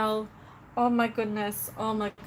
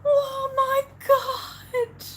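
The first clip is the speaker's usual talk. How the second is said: stunned shock; 10 semitones higher, slower than usual. Real, not acted. Live streamer reacting to Ducky's gift, stunned. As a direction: gasp it out, stunned, pitch leaping up.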